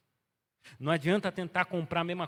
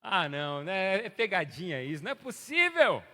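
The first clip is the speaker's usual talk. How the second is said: dismayed surprise; 3 semitones higher, 8 dB louder, slower than usual. Retold or imitated. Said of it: "a hora que você vira as costas".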